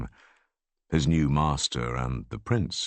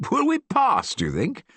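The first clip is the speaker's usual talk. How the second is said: joyous demand. A narrator's reading. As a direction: ask full of glee. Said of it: "demanded joyously".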